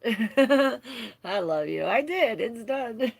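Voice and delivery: loud, high, through laughter; delighted, tearful-laugh outburst; laughing affection. Real, not acted. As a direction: burst out laughing and call it warmly to a friend. Laughing reply to viewer's message.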